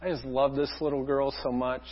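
hushed and tender, trying not to wake her. Retold or imitated. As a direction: breathe it softly, full of love. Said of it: "I'm just like".

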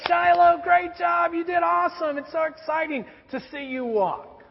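put-on high, loud, cheering praise for a toddler; proud father cheering his baby's first steps; excited praise. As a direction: cheer her on like a proud dad.

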